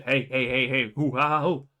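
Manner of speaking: low, fast taunting mock voice; hammed-up sneaky traitor. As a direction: tease like a cartoon villain. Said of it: "the dude again comes to you and says".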